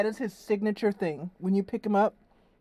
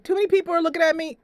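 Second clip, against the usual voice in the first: cat's voice; 10 semitones higher, 6 dB louder, much faster than usual. Retold or imitated. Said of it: "He's like".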